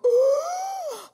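long, high, loud intake of air; huge gasp. Retold or imitated. Wife overhears patient's first breath, huge gasp. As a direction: suck in a big long breath. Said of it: "she heard me go".

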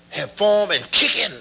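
usual speaking voice

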